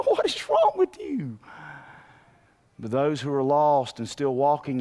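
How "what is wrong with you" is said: high mock disbelief. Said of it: "I just wanna look at people".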